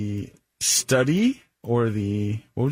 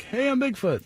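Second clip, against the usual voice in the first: high, cheery Bigfoot greeting; 10 semitones higher, 3 dB quieter than usual. Pretend. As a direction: wave hello as a proud Bigfoot. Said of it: "It's not like".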